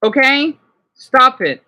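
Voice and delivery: loud, firm stop; sharp command. Real, not acted. Streamer telling someone to stop, loud and firm. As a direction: cut them off hard. Shouting at video or room.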